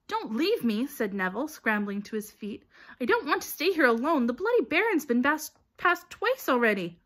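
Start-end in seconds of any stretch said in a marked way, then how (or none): 0.1-0.9 high, loud plea; alarmed, raised-pitch appeal; pleading. A narrator's reading. Neville pleads not to be left. 3.0-7.0 high, loud protest; alarmed, high-pitched appeal; frightened pleading. A narrator's reading. Neville afraid of being left alone.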